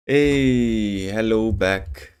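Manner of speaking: ordinary talk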